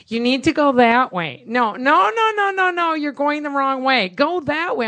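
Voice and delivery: loud, high, insistent backseat driver; bossy mom. Retold or imitated. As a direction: bark directions like a pushy mother. Mom bossing the Uber driver.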